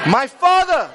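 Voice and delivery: high, loud cry of alarm; exclamation. Shown as a demonstration. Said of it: "Say it like there's an exclamation mark".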